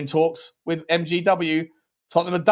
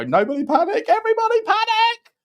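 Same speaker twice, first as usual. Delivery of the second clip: mock panic; 4 dB louder than usual. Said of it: "that go".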